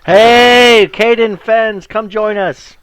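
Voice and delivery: shouted high greeting across the room; rowdy fisherman hollering a welcome; loud hail. Acted. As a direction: call them over loudly. Fisherman hailing party across tavern.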